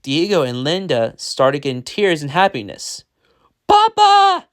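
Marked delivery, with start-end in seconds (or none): 3.7-4.5 put-on very high, loud child's yell. A narrator's reading. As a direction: squeal it out to a returning father. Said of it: "they yelled".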